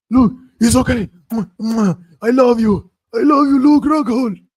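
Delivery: very high, loud, slow affectionate cry; Yoel's love for Luke. Retold or imitated. Fighter kissing his knocked-out rival, high and loud. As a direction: gush over the knocked-out opponent. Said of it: "kissing Luke Rockhold after knocking him out".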